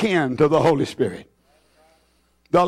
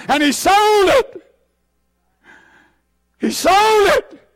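high impassioned cry; 11 semitones higher than usual. Real, not acted. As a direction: voice leaps high and pleading. Preacher climax, very high.

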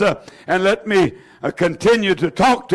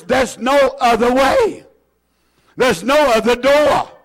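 usual speaking voice

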